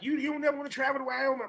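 fast, high woman's voice; quick high-pitched mimicry; imitating a woman. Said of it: "She was like".

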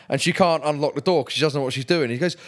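normal talking voice

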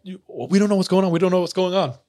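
high-pitched, imitated bewildered protest; retold flustered denial, pitched well up; mock panicked pleading. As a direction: plead innocence like a scared teenager. Retold confused teens pleading with attackers.

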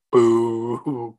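a drawn-out mock boo; mock heckling boo. Podcaster imitating himself booing through the whole movie, mock heckle. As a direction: boo like an unimpressed audience. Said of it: "sat the whole movie being like".

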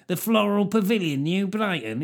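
a northerner saying the venue name with contempt; contemptuous northerner. Shown as a demonstration. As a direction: sneer it like a northerner who looks down on it. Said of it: "something a northerner would say with contempt".

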